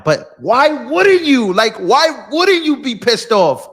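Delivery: heated, high-pitched rant; failing to calm down, voice climbing; pissed off. Real, not acted. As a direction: stretch the question in real exasperation. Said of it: "I'm getting pissed off".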